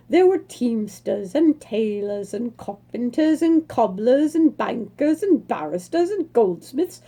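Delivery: ordinary delivery, nothing marked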